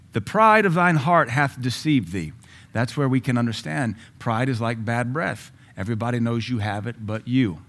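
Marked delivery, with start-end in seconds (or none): 0.1-2.3 solemn scripture reading. Real, not acted. Reads Obadiah verse slowly.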